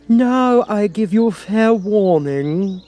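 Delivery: high, slow, booming queen's threat; shouted warning. A narrator's reading. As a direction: bellow it down at her like a furious queen. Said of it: "shouted the Queen".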